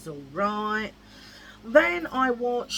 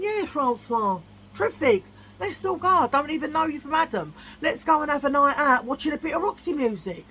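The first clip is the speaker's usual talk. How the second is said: loud mock enthusiasm; sarcastic fake cheer; 6 semitones higher, 4 dB louder than usual. Sarcastic imagined reply.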